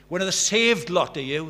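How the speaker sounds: high, loud scoffing jeer; mocking teacher. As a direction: sneer it like a scornful colleague. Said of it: "one of the teachers coming up and saying".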